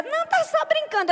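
high, sing-song fake innocence; mocking innocence. A mocking person brushing off an insult, in a high, sing-song fake-innocent voice. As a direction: play the mocker pretending it was a joke. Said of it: "É aquela pessoa".

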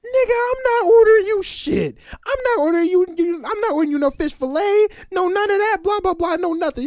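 high-pitched ranting woman impression; mock an outraged girl in a high squeal; wilding girl. Girl refuses the big man's order, high mock outrage. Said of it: "This girl gets to wild".

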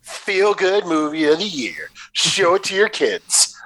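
mock-cheerful, slow tagline read; deadpan sarcasm. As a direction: sell it sarcastically, drawing the words out. Sarcastic blurb for a bleak film.

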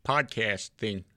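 a poor celebrity impression; Doctor Phil. Host recalling his impression, unconvincing put-on voice. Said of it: "this sounds nothing like Doctor Phil".

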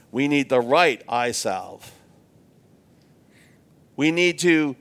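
ordinary talk

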